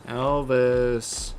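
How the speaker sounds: loud flat call of the name; calling again. Real, not acted. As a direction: call him firmly again.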